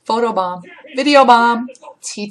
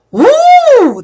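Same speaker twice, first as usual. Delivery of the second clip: high shrieking whoop; startled shriek; 9 dB louder than usual. Real, not acted. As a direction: let out a scared shriek at the spider. Spider on the wall.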